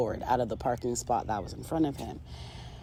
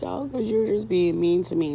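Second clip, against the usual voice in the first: higher-pitched mock weepy voice; imitated tearful complaint; 5 semitones higher than usual. Girlfriend answering why she is crying, imitated tearful complaint. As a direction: whine it like someone starting to cry. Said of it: "starts crying".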